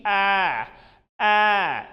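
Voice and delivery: long loud open "aah" like a sheep; teacher's bleating "ah"; sheep bleat vowel. Shown as a demonstration. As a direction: demonstrate a sheep's bleat, drawn out. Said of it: "it is bleating of sheep".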